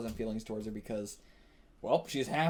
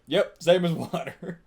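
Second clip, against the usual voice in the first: mock Garfield shrug; 5 semitones higher, 7 dB louder than usual. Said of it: "he's just gonna be like".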